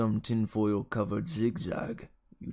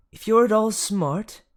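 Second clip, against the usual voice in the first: higher and louder, sneering; sneering jab; 11 semitones higher, 9 dB louder than usual. A narrator's reading. Dionysus mocking Percy's intelligence, sneering. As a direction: spit it out as an insult.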